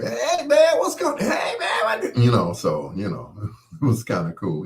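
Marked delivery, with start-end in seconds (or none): 0.0-2.2 much higher, louder laughing greeting; high, laughing hey man; voice goes up. Retold or imitated. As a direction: jump into an excited buddy voice. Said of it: "his voice went with".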